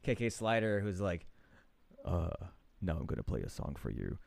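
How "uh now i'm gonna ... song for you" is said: low sexy voice. Retold or imitated. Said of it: "he has this really low, like, sexy voice".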